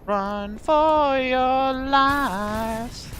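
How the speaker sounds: very slow, high, drawn-out hammy call; dramatic drawn-out cry. Pretend. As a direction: stretch it like a movie panic cry.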